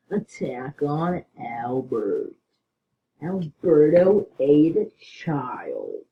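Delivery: very slow, drawn-out delivery; slow and drawn out, half whispered in places; odd slow voice. Pretend. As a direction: stretch every word out like a spooky tale. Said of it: "I don't know why I'm talking like this".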